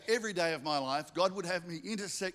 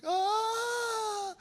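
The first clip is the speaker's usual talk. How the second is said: very high, loud cracking cry of relief; a sudden choked-up wail; tearful ah; 4 dB louder than usual. Retold or imitated. Cashier moved by the pastor's offer, crying out. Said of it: "And she's like".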